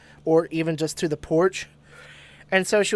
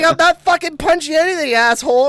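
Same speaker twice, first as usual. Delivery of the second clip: imitated mom yelling back, high and loud; angry retort; 10 semitones higher, 6 dB louder than usual. Mom denying the noise to her kid, retold angry yell. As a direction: snap back loudly as the accused mom.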